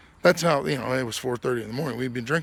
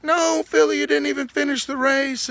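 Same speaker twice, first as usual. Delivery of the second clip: exasperated retort; 13 semitones higher, 5 dB louder than usual. Retold or imitated. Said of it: "And Disco's like".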